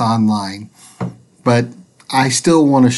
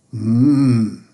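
detective hmm; 4 semitones lower than usual. Acted. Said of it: "he said".

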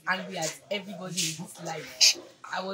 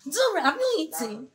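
higher, louder fussing auntie; caring lady; 8 semitones higher, 7 dB louder than usual. Retold or imitated. Speaker imitating the lady fussing over her, a bit higher. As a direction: nag kindly with concern. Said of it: "And he's always saying".

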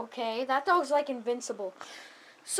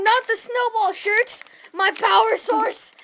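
ordinary delivery

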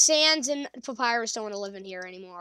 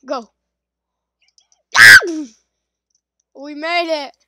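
usual speaking voice

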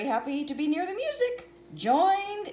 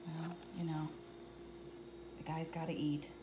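ordinary delivery

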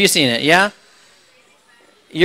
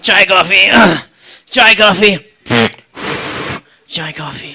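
gruff tea call. Retold or imitated. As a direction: bellow it like a bored trolley server. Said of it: "Air India, they're like".

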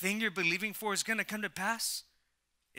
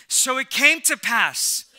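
loud, slow, ringing declaration; preaching at full force; 5 semitones higher, 9 dB louder, slower than usual. Real, not acted. Pastor leading his church in repeating the verse, loud preaching. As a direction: proclaim it for the church to echo.

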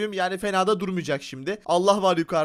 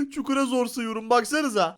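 usual speaking voice

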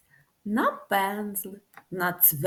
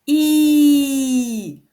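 held, loud smiling I; loud drawn-out I sound; long loud I; 3 semitones higher, 11 dB louder than usual. Shown as a demonstration. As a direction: stretch out a loud ee for the class. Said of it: "повторимо за мною".

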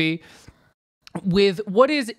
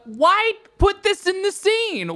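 whining at the screen; exasperated plea; 13 semitones higher, 4 dB louder than usual. Retold or imitated. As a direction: beg the film to stop. Exasperated imagined reaction.

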